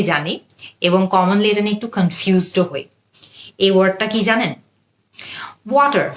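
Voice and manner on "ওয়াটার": "ওয়াটার" as WAH-der, pitched up; American pronunciation. Shown as a demonstration. Teacher quizzes learners on a common word, "ওয়াটার" as American WAH-der. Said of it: "American উচ্চারণ".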